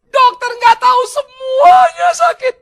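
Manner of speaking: slow, high, drawn-out shout; hammed-up patient wailing at the doctor; yelling in pain. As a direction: stretch the pained yell right out. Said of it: "dia teriak-teriak sakit".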